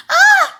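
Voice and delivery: very high, loud frightened shriek; scream. A narrator's reading. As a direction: shriek in terror. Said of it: "screamed the lump".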